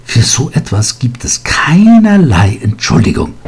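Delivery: laut und hoch angefaucht; fauchend. A narrator's reading. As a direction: schneid ihm scharf das Wort ab. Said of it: "fauchte sie ihn an". (die Lehrerin faucht Vinzenz wütend an)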